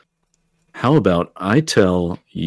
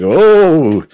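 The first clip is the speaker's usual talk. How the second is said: high, loud mock-impressed oh; adult humouring a bragging kid; sarcastic oh; 9 semitones higher, 6 dB louder than usual. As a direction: act impressed for a child. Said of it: "like, sarcastically".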